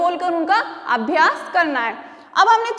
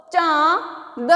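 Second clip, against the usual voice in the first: drawn out slowly; much slower than usual. Shown as a demonstration. Teacher sounding out चांद slowly for children. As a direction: stretch चांद out very slowly, syllable by syllable. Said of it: "अब इस चा में मैंने एक नया वर्ण मिलाया है".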